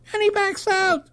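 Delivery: very high, squeezed joke-telling voice; horrified recoil. Acted. Reporter acting out Paddy's retreat, high mock horror.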